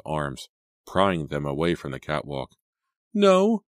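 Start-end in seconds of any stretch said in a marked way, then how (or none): 3.1-3.6 very high, loud cry of refusal; panicked high wail; desperate pleading. A narrator's reading. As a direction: plead in high-pitched terror. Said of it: "Selena pleaded".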